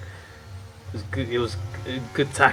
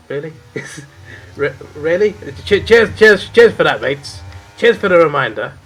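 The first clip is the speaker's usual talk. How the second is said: sarcastic thanks; 4 semitones higher, 8 dB louder than usual. Retold or imitated. Sarcastic reply to supervisor.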